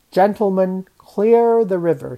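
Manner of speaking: loud, pompous public announcement; big speechmaking voice; mock prime minister. A narrator's reading. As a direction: proclaim it like a self-important statesman. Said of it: "He tried to speak like a prime minister, with a deep voice".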